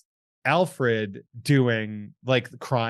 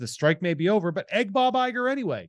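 high, hammed-up Boston accent; New England accent; 7 semitones higher than usual. Shown as a demonstration. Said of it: "I'm doing the accent the whole way".

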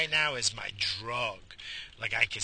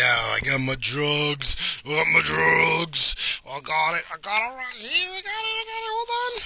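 hammed-up glee; 3 semitones higher, 6 dB louder than usual. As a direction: celebrate like an addict with a fix. Celebrates getting his coffee.

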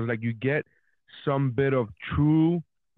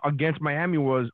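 ordinary talk

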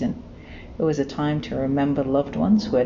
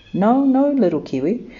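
a light sleepy owl voice, the no no raised; 7 semitones higher, 5 dB louder than usual. A narrator's reading. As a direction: brush the kiwi off, a hint of sleepy owl.

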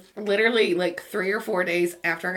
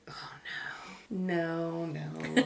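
soft, sinking dismay; retold dismay; 2 semitones lower, 9 dB quieter, much slower than usual. Driver seeing the mechanic behind the desk, quiet dismay. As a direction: say it quietly as the bad news dawns.

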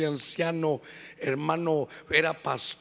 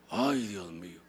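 exasperated; 12 semitones lower, 7 dB quieter, slower than usual. Retold or imitated. Exasperated sigh at excuse.

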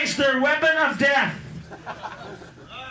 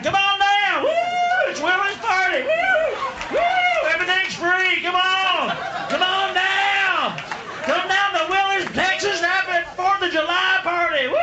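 high, shouting party host hollering woo; excited Texas party invite; 8 semitones higher, slower than usual. Retold or imitated. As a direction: whoop it up like a good-ol'-boy host. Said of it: "He's like".

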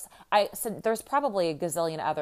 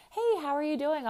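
much higher, bright friendly hello; chirpy barista greeting; 10 semitones higher than usual. Retold or imitated. To the mom at the drive-out, high cheery barista hello. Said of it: "He was like".

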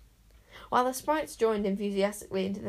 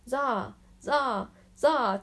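loud chant of a cheering crowd, each call rising; 4 semitones higher, 5 dB louder, much slower than usual. A narrator's reading. Wizards cheering the hero's return, loud chant. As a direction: chant the name like a celebrating crowd. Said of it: "cheered the wizards".

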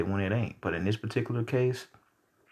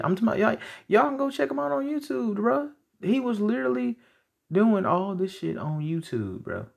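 excited; 11 semitones higher than usual. Real, not acted. Excited outburst about YouTube videos.

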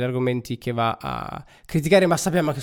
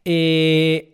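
ordinary talk

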